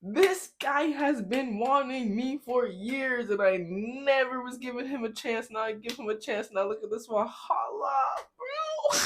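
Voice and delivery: high, slow, disbelieving rant; high incredulous rant about the guy. Real, not acted. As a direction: let the disbelief climb into a cry. Reacting in disbelief.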